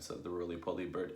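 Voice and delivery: ordinary delivery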